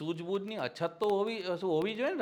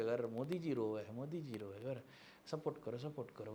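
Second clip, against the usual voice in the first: hushed mock crowd; 6 semitones lower, 10 dB quieter than usual. Crowd moved by Modi crying.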